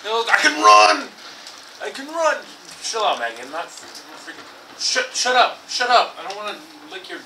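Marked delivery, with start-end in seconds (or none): 0.0-2.4 loud, high burst of glee; delighted shout at the game; excited shout. Real, not acted. Excited at new game move. 4.8-6.1 loud, high snap at a friend; mock annoyed shout; playful shout. Real, not acted. Snapping at friend teasing.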